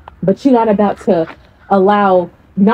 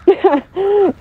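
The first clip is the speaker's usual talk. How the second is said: high laugh; 10 semitones higher than usual. Real, not acted. Laughing at own imitation.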